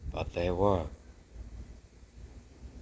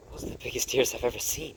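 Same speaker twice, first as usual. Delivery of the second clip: fast, higher, amazed; child mouse sister; 6 semitones higher, faster than usual. Acted. Sister staring at the new baby, fast and amazed. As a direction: rattle it off like a gawking child.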